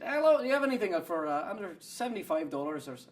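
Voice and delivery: high-pitched lilting Irish greeting; put-on Irish brogue, tripping over itself; accidental Irish accent. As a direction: attempt Scottish but slip into Irish. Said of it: "oh, no, that's Irish".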